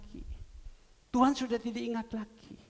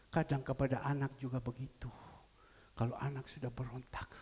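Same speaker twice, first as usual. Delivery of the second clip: usual speaking voice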